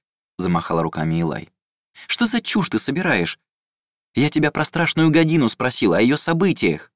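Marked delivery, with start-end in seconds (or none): 2.1-6.9 high, frustrated complaint; exasperated. A narrator's reading. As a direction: scold her in disbelief. Ilai exasperated with golem.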